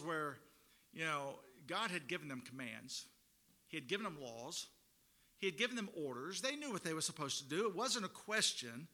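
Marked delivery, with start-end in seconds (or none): none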